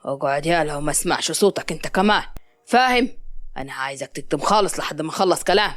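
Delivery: low-pitched stern command; restrained anger. A narrator's reading. Hisham orders captive Mazen to sit quiet, restrained anger. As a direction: order him silent in a deep, controlled voice. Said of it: "هشام قال له بغضب".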